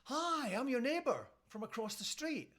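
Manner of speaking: high-pitched, chirpy doorstep greeting; imitated cheery neighbour; friendly neighbour. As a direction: introduce yourself in a high, neighbourly voice. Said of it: "says the neighbour".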